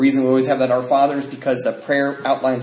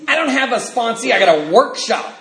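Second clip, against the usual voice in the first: normal talking voice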